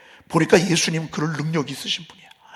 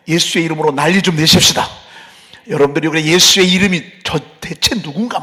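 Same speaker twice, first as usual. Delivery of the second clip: fervent exhortation; 7 dB louder than usual. Real, not acted. Preacher exhorting congregation.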